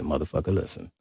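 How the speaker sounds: low, soft, fast, lightly voiced [name]; [name] impression. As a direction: murmure bas et rapide, façon [name]. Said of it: "He said".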